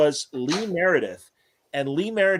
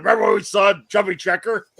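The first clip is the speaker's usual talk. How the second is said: imitating Bert; 6 semitones higher, 6 dB louder than usual. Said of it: "he's like".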